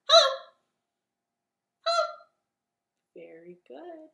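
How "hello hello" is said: fast hello. Shown as a demonstration. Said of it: "as fast as we can".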